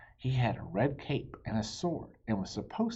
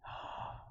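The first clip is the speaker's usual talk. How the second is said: soft gasp; 12 dB quieter than usual. Acted. Bee stings Ferdinand.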